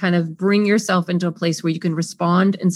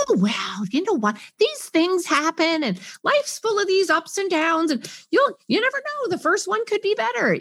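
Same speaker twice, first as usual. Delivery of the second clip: platitude comforter; 9 semitones higher than usual. Retold or imitated. Someone consoling a person who lost a recording, cheery. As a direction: offer hollow cheerful comfort. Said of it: "And somebody goes".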